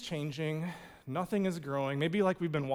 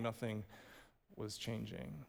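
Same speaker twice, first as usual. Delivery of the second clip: quiet; 8 semitones lower, 16 dB quieter than usual. Shown as a demonstration. Sermon repetition, dropping voice.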